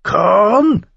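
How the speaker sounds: very high, harsh cawing bird voice; croaking raven. A narrator's reading. The raven begs Mormont for corn, high croak. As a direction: caw the word like a raven. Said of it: "krächzte der Rabe mit heiserer Stimme".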